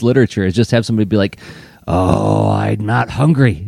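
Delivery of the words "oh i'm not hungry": slow, loud, hammed-up villain; scary voice. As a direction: intone it slowly like a storybook baddie. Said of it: "in a scary voice".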